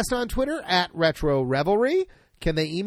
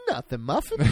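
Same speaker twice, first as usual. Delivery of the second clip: laughing reply; 5 semitones lower, 4 dB quieter than usual. Shown as a demonstration. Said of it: "That's what we'll say".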